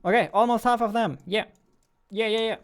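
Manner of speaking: high, loud and fast burst; high, loud, fast amazement; excited. Real, not acted. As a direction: rattle it off amazed at the count. Counter nears half.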